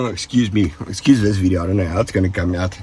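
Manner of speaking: normal talking voice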